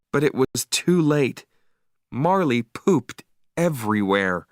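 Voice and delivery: ordinary delivery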